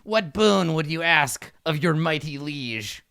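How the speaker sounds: slow, stately question; imperious kobold king. Acted. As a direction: offer a favour like a monarch. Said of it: "and he says".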